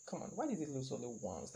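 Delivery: usual speaking voice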